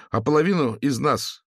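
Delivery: high, strong ringing student voice. A narrator's reading. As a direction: let the voice grow firmer. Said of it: "Голос студента окреп".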